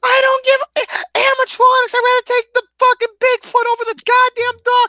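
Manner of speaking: very high, loud comic rant; worked-up yelling over a silly dispute; heated outburst. Real, not acted. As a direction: argue at a high-pitched shout. Heated comic argument over the duck.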